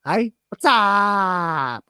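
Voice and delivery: high-pitched hollered greeting, last word stretched out; mock hyped YouTuber. Streamer imitating how American YouTubers start videos, hammed-up holler. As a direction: holler it like an over-the-top video intro. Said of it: "I notice that happens in America a lot".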